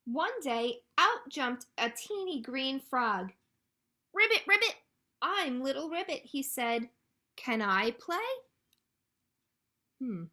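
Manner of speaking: loud, lively read-aloud with high-pitched animal lines; animated storytime reading. A narrator's reading. Librarian reads ducklings refusing a tiny frog, animated storytime delivery. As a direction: play up each duckling and frog for little listeners.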